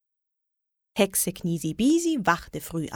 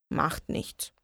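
soft, dejected resignation; unhappy; 3 semitones lower, 7 dB quieter than usual. A narrator's reading. Witch told the chocolate is sold, softly unhappy. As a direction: say it small and let down. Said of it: "jetzt noch unglücklicher".

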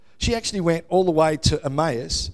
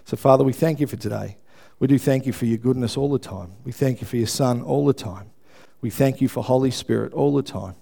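lower, steady prayer voice; 4 semitones lower than usual. Real, not acted. Pastor opening the service in prayer, humble and steady. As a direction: pray humbly over the congregation.

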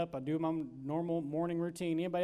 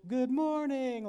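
higher, louder greeting called across a parking lot; retold yell; 8 semitones higher, 5 dB louder, slower than usual. Clients greeting the preacher from across the lot, mock shouting. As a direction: call it out like a group of clients greeting you. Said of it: "at the top of their lungs".

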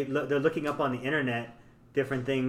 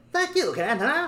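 high, put-on bobblehead cartoon voice; cartoon Canadian; 11 semitones higher than usual. South Park Canadians with bobbleheads, high mimicked voice. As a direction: imitate a flapping-headed Canadian cartoon character. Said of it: "And then they talk like this".

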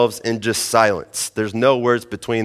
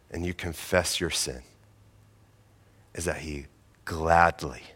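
soft, low, hushed sermon close; tender closing appeal; 6 semitones lower, 7 dB quieter than usual. Acted. Pastor quietly inviting listeners back to God, hushed and tender. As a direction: draw them in gently, almost confiding.

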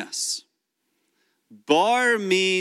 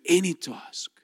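whispered; 4 dB quieter than usual. Acted. Pastor hushes a key point.

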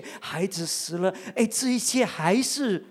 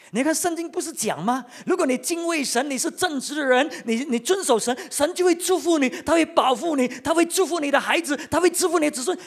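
lecturing friend, pitched higher and louder; preachy friend; 8 semitones higher, 4 dB louder than usual. Acted. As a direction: lay down the law like a smug counsellor. 讲员模仿约伯朋友的说教. (像自以为是的朋友高声说教)

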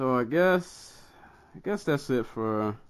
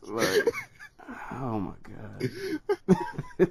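laughing; 9 semitones higher than usual. Real, not acted. Laughing at the con story.

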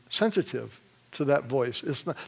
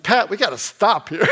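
raised, louder retold urgent call; 6 semitones higher, 7 dB louder than usual. Telling brother to stop at the car accident, urgently. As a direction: call out to the driver's companion urgently.

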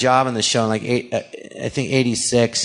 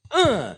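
short effortful grunt, pitched up high; high landing grunt; jump grunt; 10 semitones higher than usual. Retold or imitated. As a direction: grunt as you land a jump.

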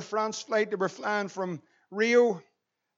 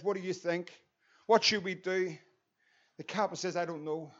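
helpless hush; 3 semitones lower, 7 dB quieter than usual. Retold or imitated. Captain hushed and helpless.

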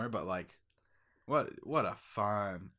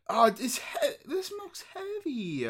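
high strained whine; struggling infomercial actor; 13 semitones higher, slower than usual. Pretend. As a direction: whine that the jug is too heavy.

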